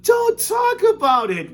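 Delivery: very high, slow wail. Acted. Mother wails to her husband to stop talking, very high and slow. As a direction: wail in distress. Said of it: "wailed Missus T V".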